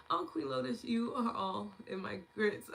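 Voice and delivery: high, slow playful tease; playful delight. Real, not acted.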